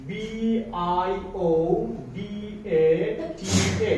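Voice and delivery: "বিআইওডিএটিএ" spelled out letter by letter, very slowly. Shown as a demonstration. Teacher spelling biodata letter by letter for teachers. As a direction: spell B-I-O-D-A-T-A one letter at a time. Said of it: "আমরা এটাকে বলছি".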